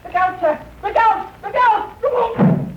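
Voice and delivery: alarmed warning cry. Acted. Billy calling a warning to Holmes, alarmed cry. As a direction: shout a warning up the stairs. Page boy warns Holmes of danger.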